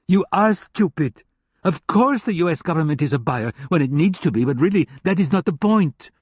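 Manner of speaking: a hint of a contemptuous German hacker lecturing. German hacker scoffs at her.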